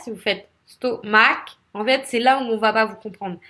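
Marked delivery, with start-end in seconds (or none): 0.7-1.6 "stomac" as sto-MAC, the end punched; weak sto, heavy MAC in "stomac"; wrong stress on last syllable. Shown as a demonstration. Teacher showing what won't be understood, "stomac" stressed on MAC. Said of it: "si vous faites l'inverse".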